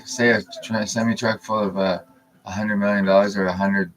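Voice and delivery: normal talking voice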